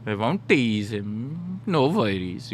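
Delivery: put-on slow, teasing Indian accent; stretched-out mock Indian-accented reassurance. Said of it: "I perfected the Indian accent".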